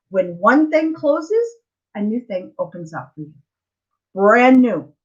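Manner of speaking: ordinary delivery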